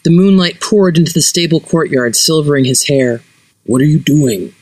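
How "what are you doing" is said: ordinary delivery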